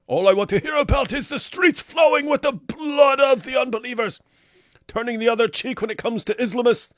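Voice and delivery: high, sing-song indignant declamation; mock ranting. Host parodies a comment against peace-talking popes, mock ranting. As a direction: declaim it like an over-the-top zealot.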